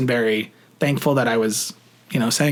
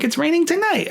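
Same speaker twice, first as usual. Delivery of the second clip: excited; 14 semitones higher than usual. Retold or imitated. Gushing at Taylor about rain.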